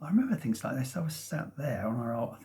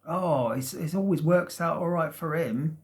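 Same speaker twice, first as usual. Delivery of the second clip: louder, higher retold grumble; a bit bitter; 4 semitones higher, 5 dB louder than usual. Speaker recalling envy of a friend's easy coming out, mildly bitter. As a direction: voice a resentful thought, lightly acted. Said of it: "I remember feeling a bit bitter".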